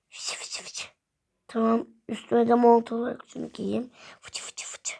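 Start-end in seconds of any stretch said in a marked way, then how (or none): 0.1-0.9 soft unvoiced swishing sounds; hushed dressing sound effect; imitated rustle. 4.2-5.0 soft unvoiced swishing sounds; hushed dressing sound effect; imitated rustle.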